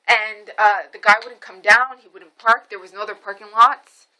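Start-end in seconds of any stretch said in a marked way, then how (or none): none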